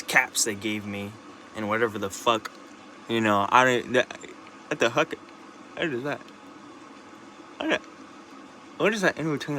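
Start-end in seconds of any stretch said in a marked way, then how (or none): 4.7-9.6 sudden higher startled question; surprised. Real, not acted. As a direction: caught off guard by something odd. Looking at teeth in mirror.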